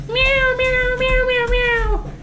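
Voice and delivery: high squeaky meows; cat meowing. Retold or imitated. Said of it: "the cats in the background going".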